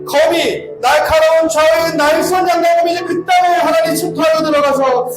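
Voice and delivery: impassioned, high-pitched congregational intercession; fervent prayer. Real, not acted. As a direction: cry out to God in long, sustained phrases. Impassioned prayer building to climax.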